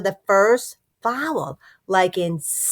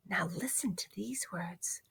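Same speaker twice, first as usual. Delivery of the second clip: whisper; 3 semitones lower, 20 dB quieter than usual. Acted. Teacher whispers to children.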